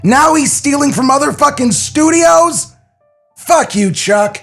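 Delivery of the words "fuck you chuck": ordinary delivery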